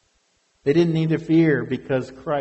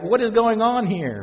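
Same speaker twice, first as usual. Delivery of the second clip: mock fear; 6 semitones higher than usual. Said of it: "crawling in fear like".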